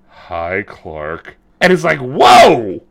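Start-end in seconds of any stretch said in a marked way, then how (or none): 0.1-1.4 low, soft "Hi, Clark"; a hammed-up deep kid's greeting; mock deep boy voice. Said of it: "hello, George". 2.0-2.8 mock surprise. Reacting to the mother's greeting.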